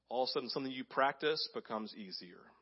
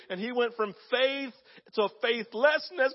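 ordinary delivery

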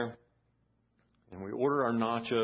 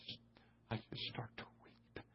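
tearful whisper; 17 dB quieter than usual. Real, not acted. Preacher reliving his weeping in class, tearful whisper. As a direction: voice drops away to a choked whisper.

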